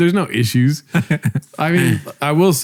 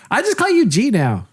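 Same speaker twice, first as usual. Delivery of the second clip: passive-aggressive; 8 semitones higher than usual. Retold or imitated. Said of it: "passive-aggressive way of being like".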